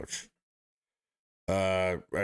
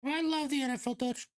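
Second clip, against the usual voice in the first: much higher falsetto fan gushing; high mocking falsetto, fan protesting. Mocking a fan defending the NFL.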